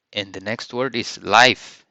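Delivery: normal talking voice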